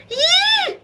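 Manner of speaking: shrieking high fright yelp; yell. Acted. Mister Rogers startled by the scarecrow, high yelp. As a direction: jump out of your skin with it. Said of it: "yelled Mister Rogers".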